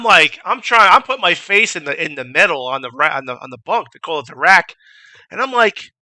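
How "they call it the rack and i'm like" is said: ordinary talk, nothing marked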